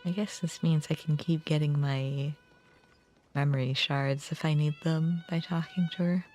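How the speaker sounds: ordinary delivery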